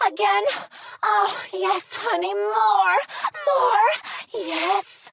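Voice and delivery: high ecstatic shouts climbing; ecstatic cries. Acted.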